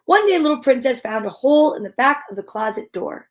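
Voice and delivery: ordinary delivery, nothing marked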